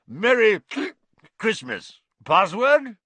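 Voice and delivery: loud, high, hearty toast and challenge; blustering knight. A narrator's reading. Knight toasting at his party, then asking password, bellowing.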